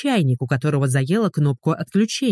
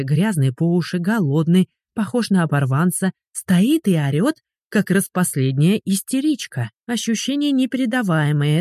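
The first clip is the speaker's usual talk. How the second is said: ordinary delivery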